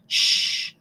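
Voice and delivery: voiceless "shh" held out; hushing shh. Shown as a demonstration. Teacher imagining someone asking for quiet, a long hushing shh. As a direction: hush someone with a sustained "shh". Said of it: "telling you to be quiet".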